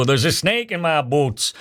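a clumsy, slowed Texan cowboy drawl; mock Woody doll voice, badly done; bad cowboy accent. Host imitating a pull-string cowboy doll, in a bad cowboy accent. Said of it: "that sounded like the worst cowboy accent".

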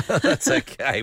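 amused, laughing through it. Real, not acted. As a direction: chuckle while saying it. Host laughs at cold studio.

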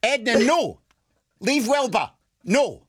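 high-pitched telling-off of pets; mock scolding. Caller interrupts herself to scold dogs, mock-stern and high. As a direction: call off naughty dogs in a put-on voice.

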